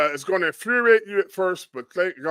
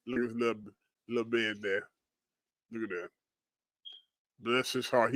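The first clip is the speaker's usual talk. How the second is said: tender cooing; 7 semitones lower, 12 dB quieter than usual. Real, not acted. Cooing at rescued dog photo.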